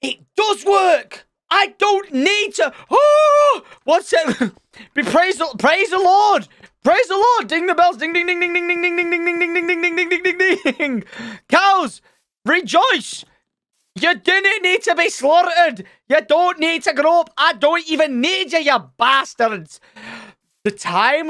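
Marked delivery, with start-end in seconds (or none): 0.0-8.0 high, jubilant cries of triumph; ecstatic, high-pitched rejoicing; elated celebration. Real, not acted. Celebrating that it works. 8.0-11.0 rapid high monotone dinging; high, unbroken bell peal; ringing bell imitation. Imitates celebration bells ringing. 11.5-19.8 high, drawn-out jubilant crowing; exultant, pitched-up taunting; triumphant proclamation. Pretend.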